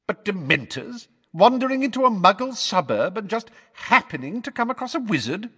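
high, mocking disbelief; snorting scorn. A narrator's reading. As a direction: scoff at the idea. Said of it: "snorted Fudge".

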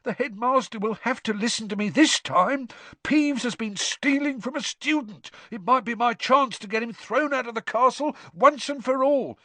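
high, loud whining grievance; plaintive. A narrator's reading. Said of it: "said Filch plaintively".

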